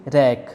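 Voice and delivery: रैक starting straight on R; silent W. Shown as a demonstration. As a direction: say रैक with no W sound before the R. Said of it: "डब्ल्यू silent".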